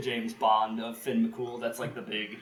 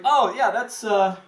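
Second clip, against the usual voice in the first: casual wife; 6 semitones higher, 5 dB louder than usual. Retold or imitated. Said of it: "And she's like".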